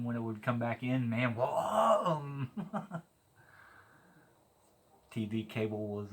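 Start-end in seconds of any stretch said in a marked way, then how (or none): 1.4-2.5 whoa. Retold or imitated. Truck banging into gear.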